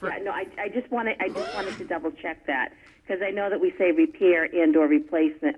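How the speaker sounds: ordinary talk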